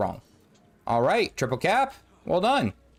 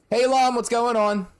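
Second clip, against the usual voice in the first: calling out; 10 semitones higher, 4 dB louder than usual. Real, not acted. Calling out to a player.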